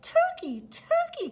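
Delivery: high, loud sing-song coaxing; sweetest voice. Acted. Moose coaxes Turkey out of his nest, sweet and high. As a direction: lure him out sweetly. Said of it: "in his sweetest voice".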